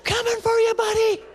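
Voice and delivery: very high, sing-song menacing voice; high creepy phone voice, laughing through it; creepy high taunt. Acted. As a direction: taunt him in a high, eerie squeal. Stranger's menacing phone threat.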